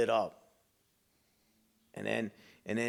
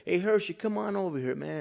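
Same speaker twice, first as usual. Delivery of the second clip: higher, mock call across the street; 8 semitones higher than usual. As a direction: call him over casually. Gang member calling him over.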